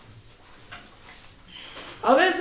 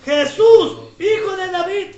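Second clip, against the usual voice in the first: loud burst on the name, rising higher; imitated shout; 4 semitones higher, 6 dB louder than usual. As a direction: act out calling over a crowd. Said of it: "Él gritaba más fuerte". (imitando un grito de llamada)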